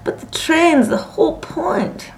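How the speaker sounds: high, drawn-out protest; tragic wail. A narrator's reading. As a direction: wail as if all is lost. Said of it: "wailed Ethel tragically".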